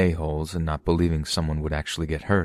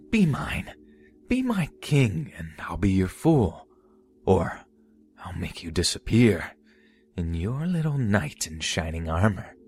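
unhinged jester; 3 semitones higher than usual. Acted. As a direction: coo it like a madman. Mad jester threatens Jeff.

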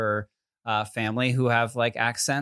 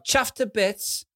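high, slow British echo; put-on British accent, pitched up; British accent attempt; 8 semitones higher, much slower than usual. Shown as a demonstration. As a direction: repeat it in drawn-out British. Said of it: "give our best shot of doing it".

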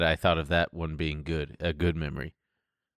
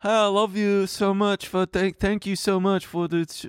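gushing thank-you voice; 12 semitones higher than usual. Retold or imitated. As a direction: gush like a grateful fan. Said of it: "me being like".

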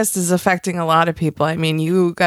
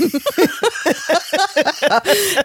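high laugh; 10 semitones higher than usual. Real, not acted. Laugh after joke.

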